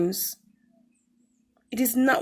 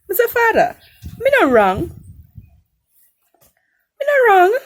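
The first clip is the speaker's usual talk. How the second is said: put-on much higher and louder, near-crying childish plea; whiny protest; 10 semitones higher, 10 dB louder than usual. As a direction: protest to God like a wailing child. Retold pleading protest to God.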